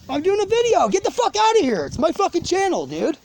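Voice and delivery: fed-up shout at an intruder; angry yell. Real, not acted. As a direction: snap it at him to get lost. Yelling at the interrupting person.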